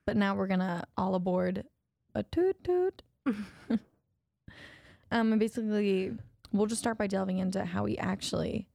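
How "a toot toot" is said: high playful toot toot; train toot. Acted. Train sound joke.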